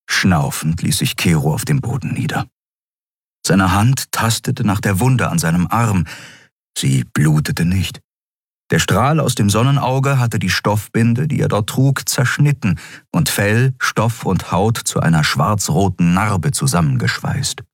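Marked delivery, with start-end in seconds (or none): none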